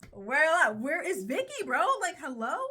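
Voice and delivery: indignant call-out; mock outrage. Real, not acted. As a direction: yell out for the missing. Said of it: "repeated louder".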